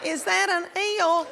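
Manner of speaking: panicked. Retold or imitated. Panicking in the ocean.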